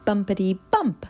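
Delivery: ordinary delivery, nothing marked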